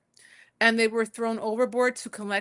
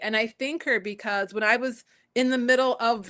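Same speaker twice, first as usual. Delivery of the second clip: ordinary talk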